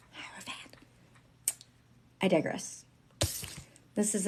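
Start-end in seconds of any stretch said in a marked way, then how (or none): 0.1-0.8 Hierophant barely voiced, in a whisper; whispered repeat. Shown as a demonstration. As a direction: whisper the word to yourself. Repeats the word under breath.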